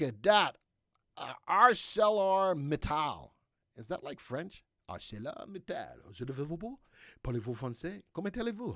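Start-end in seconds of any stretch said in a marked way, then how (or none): 4.9-6.1 low, soft mock-French accent. Host playfully tries a stock name as French, French accent. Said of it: "That's my French accent". 6.1-8.7 playful mock-French accent. Host showing off French to webcast viewers, hammed-up French accent. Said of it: "That's my French accent".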